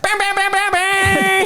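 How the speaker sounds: high-pitched rapid "bam" noises; imitated banging noise. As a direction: chant the bams way up high. Imitated noise after impression.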